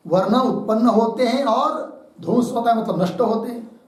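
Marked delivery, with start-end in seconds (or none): none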